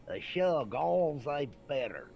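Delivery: put-on German in a thick Kentucky accent; exaggerated Kentucky-accented German; Glenn Asher. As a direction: drag the German out loud and high with a Kentucky twang. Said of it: "you need to lose that accent".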